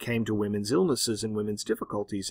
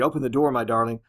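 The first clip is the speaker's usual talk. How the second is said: ordinary talk, nothing marked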